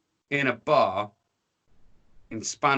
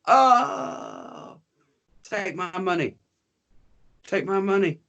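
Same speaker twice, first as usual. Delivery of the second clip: mock terrified; 7 semitones higher, slower than usual. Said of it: "And they're gonna go".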